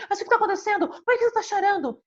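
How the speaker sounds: high-pitched imitation of an anxious mother; imitating the mother. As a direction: pitch way up as the fretting mother asking why. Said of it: "a mãe falava assim".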